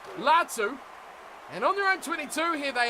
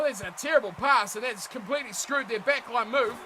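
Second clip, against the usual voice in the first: ordinary delivery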